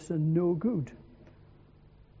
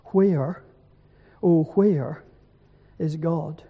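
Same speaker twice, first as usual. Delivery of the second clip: usual speaking voice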